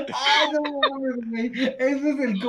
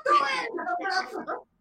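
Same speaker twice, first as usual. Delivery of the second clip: mock fan shout; 5 dB quieter, faster than usual. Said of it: "Y el otro güey".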